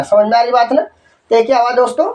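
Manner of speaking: normal talking voice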